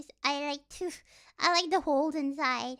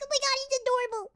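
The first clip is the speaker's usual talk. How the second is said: gushing fangirl; 8 semitones higher, much faster than usual. Retold or imitated. Mocking normies gushing over Sunny Angels, squealing high. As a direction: squeal like an excited collector. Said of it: "They're like".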